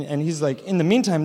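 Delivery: normal talking voice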